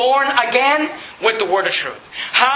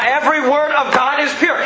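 "word" stressed in an insistent verse; emphasis on the words; 4 semitones higher than usual. Shown as a demonstration. Preacher re-quoting the proverb to his congregation, emphatic. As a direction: lean on "word" to prove the point. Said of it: "The emphasis is on the words".

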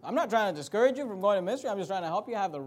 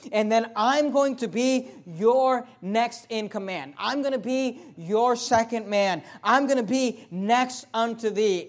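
loud, high preacher's cry; preaching at full force; 6 semitones higher, 8 dB louder than usual. Acted. Preacher voicing Jonathan promising David the kingdom. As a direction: sell the promise hard.

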